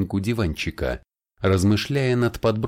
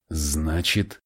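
slow, thoughtful; 3 semitones lower than usual. Acted. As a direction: stretch значит slowly while thinking. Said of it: "медленно проговорил Фабиан". (медленно и задумчиво «Значит»)

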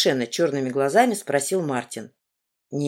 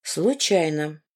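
slow, cold, even reply; icy tone; much slower than usual. A narrator's reading. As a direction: answer back just as coldly. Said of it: "ровно таким же тоном ему ответила".

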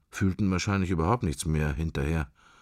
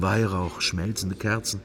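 ordinary delivery, nothing marked

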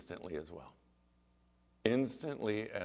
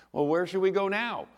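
higher, louder excited question; eager quote; 9 semitones higher, 7 dB louder than usual. Retold or imitated. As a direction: ask it buoyed up, raised voice. Mother suddenly walking, retold triumphantly.

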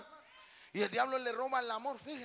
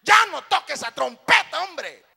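loud, high complaint, falling at the end; acted scolding shout; 7 semitones higher, 20 dB louder than usual. Annoyed churchgoer to the trumpet player, mock scolding shout. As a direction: yell at the player to stop.

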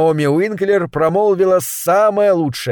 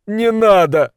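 high, imploring protest; pleading; 5 semitones higher, 3 dB louder, slower than usual. A narrator's reading. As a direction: beg her to stop, voice lifted. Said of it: "взмолился Милт". (умоляюще)

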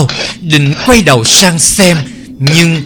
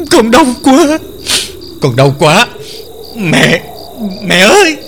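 high, pleading cries of pain; calling louder; 5 semitones higher than usual. Acted. Bound girl crying out to her mother in pain. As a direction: call out to mother, hurting. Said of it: "càng gọi to hơn".

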